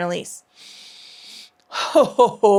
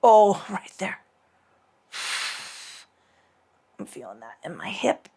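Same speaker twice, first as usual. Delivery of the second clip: in a yoga twist; slower than usual. Real, not acted. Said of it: "All right, I'm gonna unwind".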